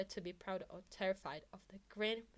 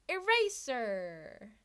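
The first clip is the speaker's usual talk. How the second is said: drawn-out louder call; 2 semitones higher, 7 dB louder, much slower than usual. Acted. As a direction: stretch the name out slow. Mike calling out.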